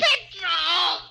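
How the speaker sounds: anguished cry. Acted. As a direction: cry out after him. Final despairing cry.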